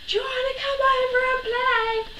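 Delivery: high friendly yell across gardens; called out. Retold or imitated. Neighbour kids calling up to the tree, shouting. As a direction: call out like kids on a trampoline. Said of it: "they shouted at me".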